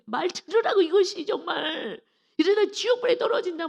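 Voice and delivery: high, loud, worked-up outburst; angry scolding. Acted. Parent acting out anger at a disobedient child, angry scolding. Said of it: "막 화가 나는 거예요".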